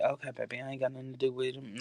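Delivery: soft, unbothered acted brush-off; quiet dismissal. Host brushing off keyboard warriors, quiet mock dismissal. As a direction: wave them away under your breath. Voicing dismissal of online critics.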